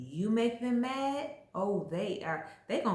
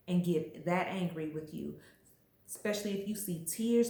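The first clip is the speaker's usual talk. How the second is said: ordinary talk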